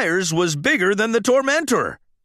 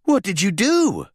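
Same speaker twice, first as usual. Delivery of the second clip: a lightly voiced young race car, startled; Lightning's voice; 4 semitones higher than usual. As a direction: ask the friend in disbelief.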